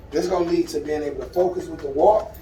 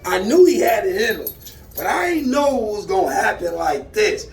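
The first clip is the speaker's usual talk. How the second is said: amazed, higher voice with drawn-out words; real excitement; 3 semitones higher than usual. Real, not acted. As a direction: stretch the key words, thrilled. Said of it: "I am excited now".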